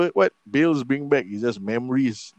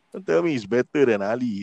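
ordinary talk

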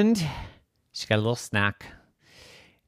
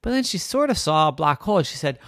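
usual speaking voice

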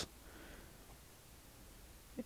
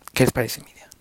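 whispered aside; 2 semitones lower, 6 dB quieter, faster than usual. Real, not acted.

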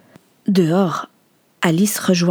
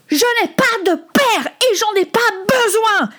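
high-pitched furious yell; howling. Acted. Son running off disowns his father, howling. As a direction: scream it out at the top of the range. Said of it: "Il hurla".

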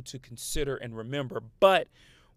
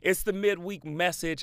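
ordinary talk, nothing marked

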